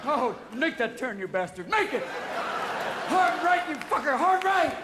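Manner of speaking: high, urgent yells, building; frantic luge shouting. Acted. Luge rider yelling at his partner to turn, frantic shouting. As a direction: bellow steering orders, voice climbing.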